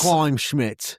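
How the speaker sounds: put-on British pronunciation of the store name; mock British accent. Trying out Kleinschmidt's, in a mock British accent. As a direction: echo it in a hammed-up British accent. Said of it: "Kleinschmidt's in a fucking British accent".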